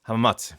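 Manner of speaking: hamamatsu with a barely-there final oo; rough imitation of Japanese "hamamatsu", ending nearly "mahts"; attempted Japanese pronunciation. Shown as a demonstration. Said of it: "that's a terrible imperson-, impersonation".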